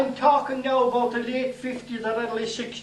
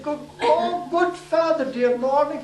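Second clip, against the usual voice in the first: a raised, louder greeting that stumbles over its words; a fallen man trying to stay grand and tripping on the words; flustered greeting; 6 semitones higher, 4 dB louder, slower than usual. Retold or imitated. Blacksmith on the ice greeting the priest, flustered and raised.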